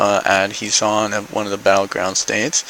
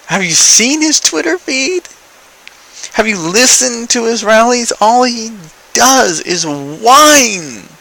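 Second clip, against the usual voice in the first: high, loud incredulous challenge; exasperated retort; 12 semitones higher, 5 dB louder than usual. Retold or imitated. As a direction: push the pitch way up in exasperation, drawing out the end. Incredulous retort to Trump supporter.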